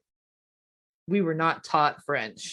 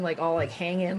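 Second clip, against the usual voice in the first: ordinary delivery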